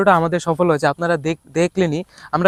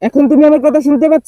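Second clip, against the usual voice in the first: loud, higher shout of the question; shouting into the string phone to a teammate; loud call; 7 semitones higher, 5 dB louder than usual. Real, not acted. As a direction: call it out across the distance. Testing string phone loudly.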